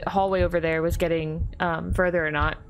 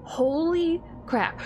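shocked; 6 semitones higher, much slower than usual. Real, not acted. Jump scare in horror game.

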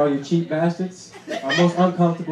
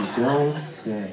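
sarcastic; 5 semitones lower, slower than usual. Retold or imitated. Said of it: "sarcastic retort to dad".

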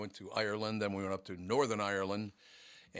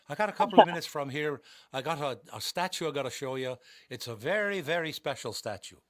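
higher-pitched Irish cabbie imitation; attempted Irish accent; 5 semitones higher, 6 dB louder than usual. Taxi driver pitching a special statue, imitated Irish accent. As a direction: have a modest go at an Irish accent. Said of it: "it actually wasn't bad".

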